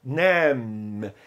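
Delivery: "nem" with a long drawn-out vowel; stretched vowel. Shown as a demonstration. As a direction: hold the vowel in "nem", low pitch. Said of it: "você deve esticar o som dessa vogal".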